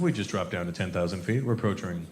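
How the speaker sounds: low airline pilot voice; low, understated captain's update; pilot announcement. Acted. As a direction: announce it like a captain over the cabin. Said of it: "the pilot will make an announcement".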